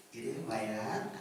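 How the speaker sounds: put-on a soft voice for a five-year-old; gentle kid-level question; talking to a small child. Shown as a demonstration. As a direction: ask it the way you'd ask a toddler. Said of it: "이렇게 바꿔요".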